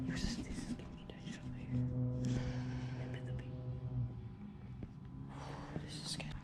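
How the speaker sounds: fast, nervous whisper; scared whisper. Real, not acted. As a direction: breathe the fear out quietly. Scared in abandoned place.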